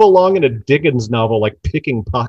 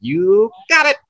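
Badgie impression; 9 semitones higher, 4 dB louder than usual. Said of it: "that's always my reply is that".

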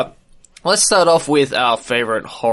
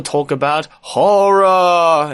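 spooky voice; 2 semitones higher, 4 dB louder, much slower than usual. Pretend. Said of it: "the reason why we're talking like this".

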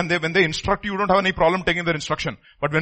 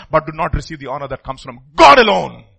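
voice breaking; 4 semitones lower, 7 dB louder than usual. Real, not acted. Preacher's voice cracks.

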